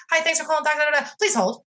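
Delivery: a hint of a sing-song receptionist recording; automated greeting. Imitating the pediatrician's phone line, mildly chirpy receptionist. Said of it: "they say".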